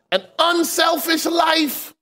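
slow, ringing shout of the answer; preached climax. Acted. Preacher answering his own question to the church, slow and ringing. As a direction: call out the answer to the congregation.

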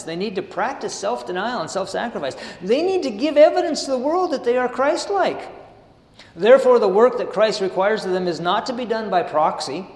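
measured sermon reading of the text; steady devotional reading voice; reading aloud. Acted. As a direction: read the passage out to the congregation. Reads the passage aloud.

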